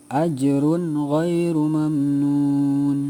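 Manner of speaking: very slow chant, the n of "Ajrun" clear before gh; Quran recitation voice. Shown as a demonstration. Teacher chanting the verse for pupils, slow. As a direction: intone "Ajrun ghairu mamnun" slowly and clearly. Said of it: "Diulang ya".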